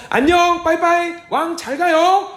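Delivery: parting calls between David and Saul; shouted goodbye. Acted. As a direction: yell goodbye across the field. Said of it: "자, 서로 소리 지르고".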